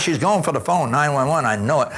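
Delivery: ordinary delivery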